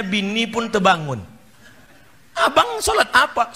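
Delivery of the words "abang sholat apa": high-pitched questioning wife; wife's voice. Acted. Preacher voices wife waking up. (suara istri bernada tinggi)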